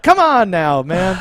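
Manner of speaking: high, loud, lazy grumble; mock laid-back complaint. Shot guy mocked as unbothered, loud whine. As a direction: whine it like nothing hurts. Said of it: "he was just like".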